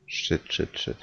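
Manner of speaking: rapid hushed panic mutter; whispered alarm in a scary game; whispered swearing. Real, not acted. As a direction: swear under your breath, barely any voice. Startled in a horror game.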